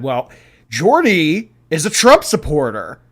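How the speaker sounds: loud, slow, high-pitched mock quote; exaggerated tattling imitation. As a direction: mimic her accusation, drawn out and hammed up. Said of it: "She has come on here and said".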